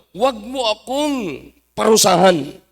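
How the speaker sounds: slow, drawn-out acted pleading; preacher stretching the devil's entreaty; devil's plea. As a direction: drag out each word of the plea. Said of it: "Anong pakiusap ng diablo?".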